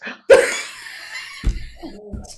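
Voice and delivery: high, squealing burst of laughter; long, high laugh; helpless laughter. Real, not acted. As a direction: crack up helplessly at the mishap. Host hears colleague fell downstairs.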